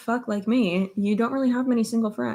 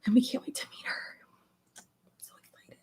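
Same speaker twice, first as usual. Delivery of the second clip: excited whisper; 4 semitones higher, 7 dB quieter, faster than usual. Real, not acted. Confiding excitement to chat.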